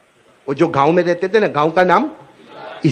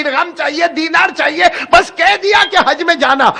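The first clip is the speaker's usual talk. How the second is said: angry mother; 9 semitones higher than usual. Acted. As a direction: scold him like a furious mother. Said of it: "وہ غصے ہو گئی". (غصے میں ڈانٹتی ماں کی اونچی آواز)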